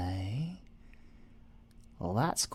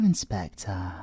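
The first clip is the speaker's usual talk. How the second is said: ordinary talk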